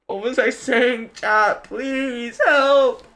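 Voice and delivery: high, loud mock plea; playful gamer girl headset. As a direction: squeal for help to chat. Calls chat for help.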